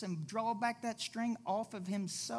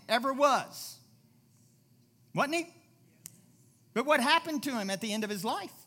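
loud, building sermon; preaching; 3 semitones higher, 4 dB louder than usual. Acted. Pastor telling of Solomon's fall, preaching loudly. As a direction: drive it home to the crowd.

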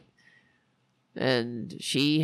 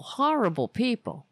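ordinary talk, nothing marked

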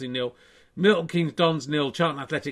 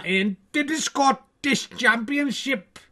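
higher, slow put-on Scottish accent; 9 semitones higher than usual. Reading Scottish football results to viewers, in a mock Scottish accent. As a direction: read the Scottish scores in a Scottish accent.

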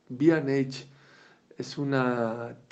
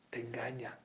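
whisper; 12 dB quieter than usual. Real, not acted. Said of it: "whispered warning".

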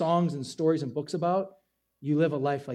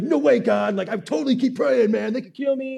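higher and louder, puffed-up complaint to God; mock angry prayer; 6 semitones higher, 5 dB louder than usual. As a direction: rant at God all worked up, half in jest. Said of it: "I'm getting all mad".

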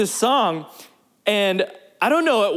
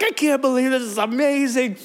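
higher, overcome with amazement, retold; lightly hammed-up weepy delight; mock tearful joy; 4 semitones higher than usual. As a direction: imitate someone happily overwhelmed.

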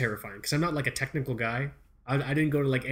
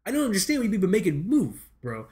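higher-pitched comic incredulity; retold bafflement; 6 semitones higher than usual. Customer not understanding the hardware clerk's advice, baffled. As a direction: question it in exaggerated, high-pitched confusion.

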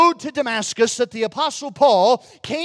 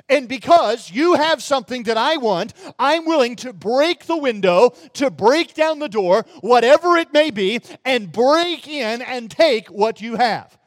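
usual speaking voice

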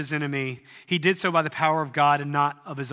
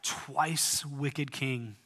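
twice wicked king drawn out slowly and softer; slow, soft emphasis; 6 dB quieter, much slower than usual. Shown as a demonstration. Pastor slowly spells out the king's name to the congregation, softly. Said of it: "We know that his name was".

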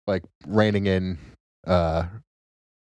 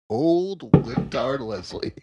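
mocking title; 5 semitones higher, much slower than usual. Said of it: "is what they called him".

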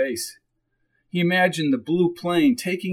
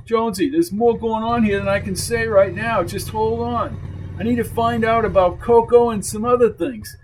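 higher-pitched tough guy pressing to leave; insistent, raised-pitch character voice; tough biker uncle, urgent; 6 semitones higher than usual. Acted. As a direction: voice a wary biker urging escape. Voices Dulio giving the plan.

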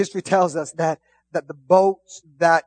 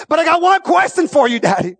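preaching; 10 semitones higher, 7 dB louder than usual. Retold or imitated. Daughter's question at climax.

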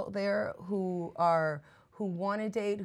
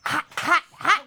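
hot, hot, hot, higher and louder each time; excited hype; 7 semitones higher, 7 dB louder than usual. Acted. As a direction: hype her up like a showman. Host hyping the guest.

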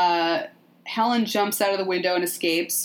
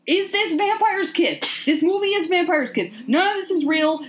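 very high, insistent put-on voice; mock convinced co-host; 10 semitones higher, 3 dB louder than usual. Co-host convinced it's all imagined, high mock insistence. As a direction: imitate an excited friend sure of a theory. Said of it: "you were also convinced at this part".

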